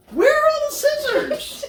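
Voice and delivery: high laughing cry; exasperated and laughing; laughing exasperation. Real, not acted. Frustrated untying toys.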